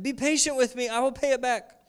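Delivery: high, pleading servant; begging. A narrator's reading. Servant begging his fellow servant for mercy, pleading. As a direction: plead for mercy on your knees. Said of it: "he begged".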